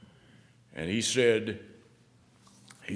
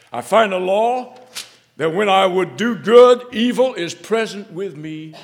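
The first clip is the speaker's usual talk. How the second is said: loud, higher reading of Paul; fervent scripture reading; 7 semitones higher, 13 dB louder than usual. Acted. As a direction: declare the verse with force. Preaching scripture at full force.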